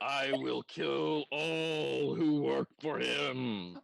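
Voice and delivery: very slow, loud monster boom; booming monster threat. Acted. Monster pie vows death on the chef's workers, booming. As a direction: roar the vow, stretching every word.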